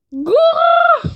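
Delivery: very high shouted coo; shrieked pigeon coo. Acted. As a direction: screech like a furious pigeon. Pigeon cry at day end.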